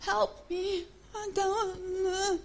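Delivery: high, soft, drawn-out whimper; imitating a weak, wavering prayer; mock helpless plea. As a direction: plead feebly, as if giving up. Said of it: "And we go".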